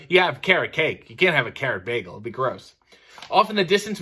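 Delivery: plain reading voice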